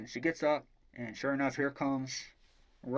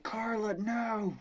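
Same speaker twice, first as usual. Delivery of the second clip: high, drawn-out mock wail; hammed-up hero crying out over his dying love; anguished cry; 10 semitones higher, slower than usual. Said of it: "And he's like".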